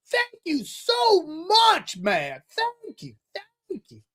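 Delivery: put-on high, falsetto old-man voice; grateful older man.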